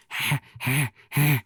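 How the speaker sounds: rough monster laugh in short bursts; Elmo. Retold or imitated. Host imitates how the puppet still sounds, monster laugh. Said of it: "he's still going like".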